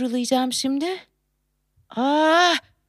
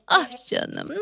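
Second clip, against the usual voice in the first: ordinary delivery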